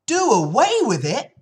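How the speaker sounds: high, loud, rising disbelief; horrified shriek. Acted. Fern reacts to her father's decision, shrieking. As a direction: shriek it as an appalled child. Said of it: "shrieked Fern".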